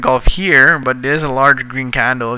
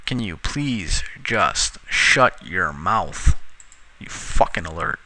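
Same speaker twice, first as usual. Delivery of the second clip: slow, lower scolding at a beeping alert; exasperated scolding; 6 semitones lower, 6 dB quieter, slower than usual. Real, not acted. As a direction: drag it out through gritted teeth. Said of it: "I am on my last nerve right now".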